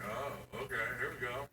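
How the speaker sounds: soft, low, fading; drowsy. Real, not acted. Drunk drifting off.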